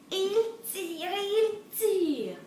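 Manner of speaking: high, drawn-out heave; straining pull refrain. A narrator's reading. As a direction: make the pulling long and effortful. Story's repeated pulling refrain.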